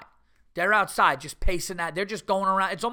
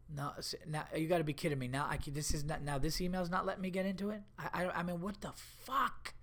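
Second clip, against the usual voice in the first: frustrated muttering; 11 dB quieter than usual. Real, not acted.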